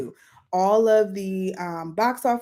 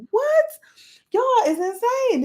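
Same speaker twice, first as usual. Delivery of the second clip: very high, loud amazed what; shocked disbelief; 4 dB louder than usual. Retold or imitated. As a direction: squeal in disbelief. Reacting to girls' road trip.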